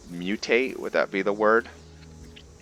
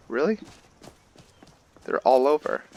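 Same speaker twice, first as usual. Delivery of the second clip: incredulous surprise; 8 semitones higher than usual. Real, not acted. Game says no trees nearby.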